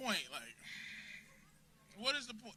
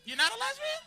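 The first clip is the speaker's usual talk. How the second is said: mock disbelief; 11 semitones higher, 5 dB quieter, faster than usual. Real, not acted. Mocking disbelief at audience member.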